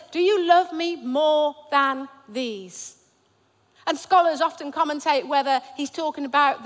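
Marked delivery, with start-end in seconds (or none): 0.1-3.0 slow, drawn out; Jesus pressing Peter, slow and searching; slow, weighted question. Shown as a demonstration. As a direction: lean on each word slowly. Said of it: "It says".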